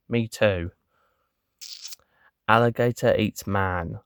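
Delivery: usual speaking voice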